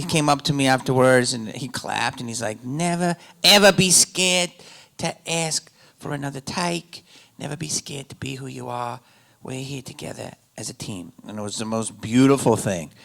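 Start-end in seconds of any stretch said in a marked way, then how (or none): 2.6-11.1 put-on hushed Australian accent, fading softer; soft, earnest mock-Australian voice; Baz. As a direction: imitate an Australian director gently reassuring an actor. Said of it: "I'll try to do the accent".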